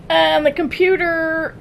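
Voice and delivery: ordinary delivery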